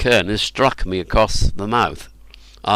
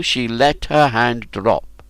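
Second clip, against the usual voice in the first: plain reading voice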